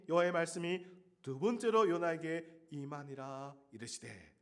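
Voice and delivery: normal talking voice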